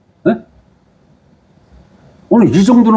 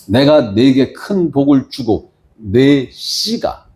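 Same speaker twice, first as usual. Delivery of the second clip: measured, lower Bible reading; scripture reading; 4 semitones lower than usual. Acted. Pastor reads Genesis to the congregation, solemn reading. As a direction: read the verses with solemn weight.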